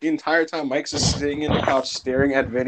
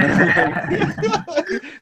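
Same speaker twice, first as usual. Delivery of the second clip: helpless rising giggle, pitched way up; high giggling laugh. Real, not acted. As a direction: let the laugh climb out of control. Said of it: "I was dying".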